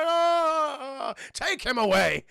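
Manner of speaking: high, drawn-out mock wail; hammed-up Cabbage Man. Retelling the cabbage seller's outburst, hammed-up wail. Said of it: "he's like".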